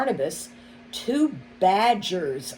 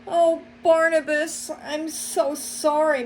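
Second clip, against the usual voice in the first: higher, loud lament; wailing; 10 semitones higher, 4 dB louder than usual. A narrator's reading. Rabbit wailing as the mole is arrested, higher and loud. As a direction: cry out sorry as he's led away. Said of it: "Missus Rabbit wailed".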